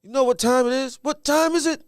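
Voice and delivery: high, loud pump-up shout to the team; Ray Lewis hype speech. Retold or imitated. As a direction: fire up the crew before kickoff. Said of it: "that Ray Lewis".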